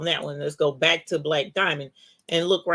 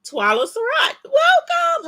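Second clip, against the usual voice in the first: very high, louder welcome; excited welcome; 7 dB louder than usual. Real, not acted. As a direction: sing out the welcome, voice soaring. Welcoming a new channel member.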